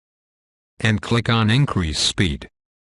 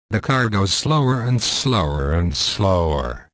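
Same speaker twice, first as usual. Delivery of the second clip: slowing down. Shown as a demonstration. Voice tutorial demonstrating the speed control, slowing down. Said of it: "Let's see an example".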